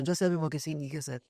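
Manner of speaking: usual speaking voice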